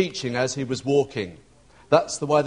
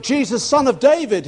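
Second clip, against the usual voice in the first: raised, higher-pitched retold cry; calling out; 9 semitones higher, 4 dB louder than usual. Beggar recognises the Messiah, calling out. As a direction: call the name out urgently. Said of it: "He called out".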